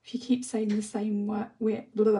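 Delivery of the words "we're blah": real slip of the tongue, given up on; tongue-tied stumble. Real, not acted. Reading a viewer's comment aloud and fumbling it, tongue-tied. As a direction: trip over the word, then blurt a louder nonsense noise. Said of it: "I can't even speak".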